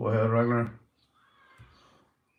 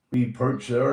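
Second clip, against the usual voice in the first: ordinary talk, nothing marked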